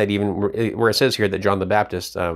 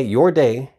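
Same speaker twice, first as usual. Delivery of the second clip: "your" punched, "your day" louder; stress on your; 3 semitones higher, 4 dB louder than usual. Shown as a demonstration. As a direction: slam the word "your". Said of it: "I'm gonna emphasize the word your and you".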